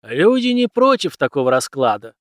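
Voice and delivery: loud, forceful interruption; emphatic teacher overriding an objection; cutting him off. A narrator's reading. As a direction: cut in firmly over the pupil. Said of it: "оборвал меня учитель".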